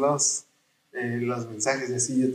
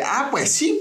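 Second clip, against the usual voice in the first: mock retort; 10 semitones higher, slower than usual. Propone una réplica al amigo.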